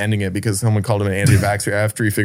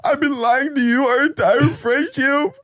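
high, slow mock sob; mock crying; 13 semitones higher, slower than usual. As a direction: wail it like a drunk crying friend. Drunk crying friend confesses.

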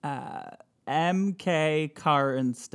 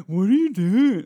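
higher, louder taunting whine; mocking sibling, louder; 7 semitones higher, 7 dB louder than usual. Mocking someone unsure of what to do, louder. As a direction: rub it in like a bratty sibling. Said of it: "a sibling making fun of someone".

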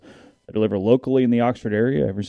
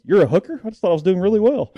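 high-pitched, louder mock outburst; imitated movie yelling; 8 semitones higher, 3 dB louder, faster than usual. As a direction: yelp it like a comic film character. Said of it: "He starts yelling".

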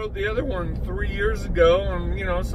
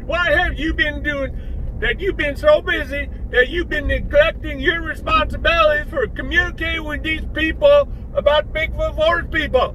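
higher hillbilly sidekick nagging; hillbilly Bubba voice; 8 semitones higher than usual. Acted. Bubba nags the doctor about neglecting fans, in a higher hillbilly voice.

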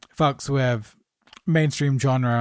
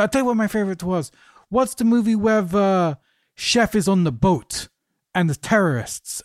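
higher, hammed-up French accent, imitating a French actor; jokey imitation of a French accent, higher pitched; bad French accent; 4 semitones higher than usual. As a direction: put on a playful mock French accent. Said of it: "excuse the bad French accent".

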